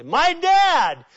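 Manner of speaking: retold sobbing outcry; weeping cry. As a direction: cry out to him as she breaks. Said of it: "She began to weep loudly".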